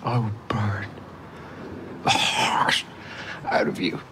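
whispered threat, soft and close; unvoiced whisper of a threat; menacing whisper. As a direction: breathe the threat into his ear. Villain repeats threat whispering.

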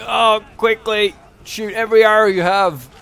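high, loud, drawn-out mock plea; hammed-up desperate soldier; frantic archer voice. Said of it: "And they're just like".

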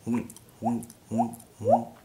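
hoink with a breathy h, hollow pop; hoink drip. Shown as a demonstration. Teaching the drip, hollow hoink pops. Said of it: "make sure it comes out at the same time".

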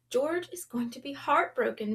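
ordinary delivery

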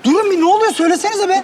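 shouting. Acted. Man attacked, demanding explanation.

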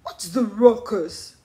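story character asking, a touch gruffly; Norman the ape. A narrator's reading.

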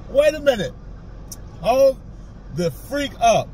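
ordinary delivery

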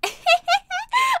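a sudden high squealing laugh; shrieking laugh; high burst of laughter. Acted. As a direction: crack up at the silly answer.

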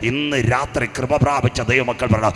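loud, high, slowed sermon declaration; fiery preaching. Acted. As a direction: hammer each phrase to the church. Preacher at full force again.

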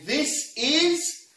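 high, drawn-out announcer build-up; presenter's big dramatic wind-up; showy flair. Real, not acted. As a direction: stretch it out with a loud flourish. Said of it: "you like the flair".

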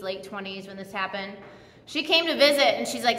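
ordinary delivery

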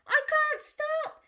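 high, loud, slow panicked cry; mooing shout. Acted. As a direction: shout it as you run out of control. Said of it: "she mooed with a shout".

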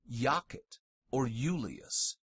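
Iacet and Iulius starting with Y; consonant I as Y. Shown as a demonstration. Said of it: "it sounds like our consonant Y".

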